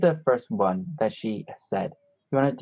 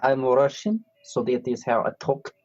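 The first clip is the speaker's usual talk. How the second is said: halting put-on Russian accent; fake Russian accent; 2 semitones higher than usual. Host claiming this is normal speech, in a fake Russian accent. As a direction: play a Russian speaker searching for English words. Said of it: "I only do Russian accent".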